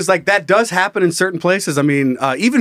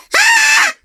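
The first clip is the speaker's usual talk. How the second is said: high, loud comic shriek; mock scream; 4 dB louder than usual. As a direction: shriek like a waiter gone berserk. Acts out waiter's screaming entrance.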